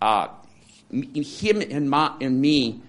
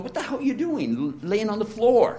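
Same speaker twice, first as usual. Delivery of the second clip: mocking neighbor; 8 semitones higher than usual. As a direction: mimic a baffled neighbor, pitched up. Neighbors asking, mock incredulous.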